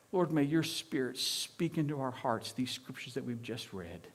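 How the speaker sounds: soft, low, reverent prayer. Real, not acted. Preacher praying over scriptures just read, hushed prayer.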